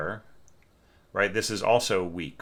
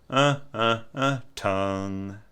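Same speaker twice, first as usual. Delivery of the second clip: tongued pulses demo; 2 semitones higher, slower than usual. Shown as a demonstration. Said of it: "If we move it back".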